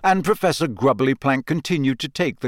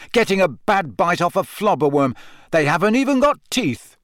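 spat out; 6 semitones higher, 4 dB louder than usual. A narrator's reading. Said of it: "Harry spat at Malfoy".

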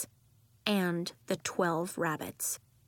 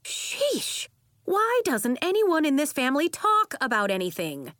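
high, loud exasperated outburst; exasperation, pitched up and forceful; exasperated aunt; 8 semitones higher, 4 dB louder than usual. A narrator's reading. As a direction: burst out fed up with the whole family.

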